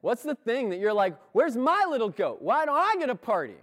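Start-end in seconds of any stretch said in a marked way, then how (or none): 1.3-3.5 a put-on sulky whine, pitched up and louder; whiny voice. Older brother sulking about his goat, mock whiny. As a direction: whine like a child denied a treat. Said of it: "Say it in that whiny voice".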